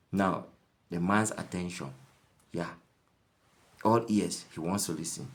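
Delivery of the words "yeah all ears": ordinary delivery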